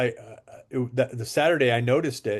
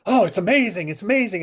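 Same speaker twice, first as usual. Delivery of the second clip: mock gushing, pitched up high; overexcited gushing; 7 semitones higher, 4 dB louder than usual. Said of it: "acting a little bit overexcited about this water".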